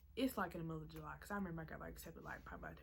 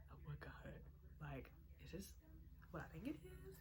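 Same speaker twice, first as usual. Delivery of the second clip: breathy whisper of disbelief; hushed re-enacted disbelief; stunned whisper; 9 dB quieter than usual. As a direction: whisper it, barely daring to believe. Retelling first sight of acceptance email.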